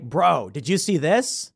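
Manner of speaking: slow, drawn-out casual demo; casual bro example. Shown as a demonstration. Explaining bro to listeners, slow casual example. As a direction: show how bro is used offhand. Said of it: "people will say bro".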